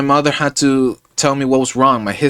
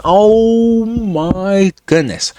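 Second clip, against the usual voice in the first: drawn-out exasperation; 4 semitones higher, much slower than usual. Real, not acted. Exasperated at hospital mix-up.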